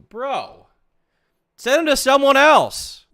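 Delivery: high, loud, drawn-out disbelieving yell; incredulous yell. Real, not acted. Reacting to her DMs with a high, loud disbelieving yell. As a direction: yell it, fed up with what she wants.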